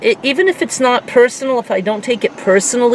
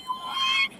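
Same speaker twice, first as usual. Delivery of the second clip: high cracking shriek of shock; 12 dB quieter than usual. Retold or imitated. Retelling the prank, shrieking in shock. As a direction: shriek it like you just got scared. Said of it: "I felt the blood rush".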